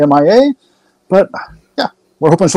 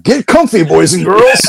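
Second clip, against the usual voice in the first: ringmaster; 6 semitones higher, 3 dB louder, slower than usual. Pretend. Mock announcer repeat.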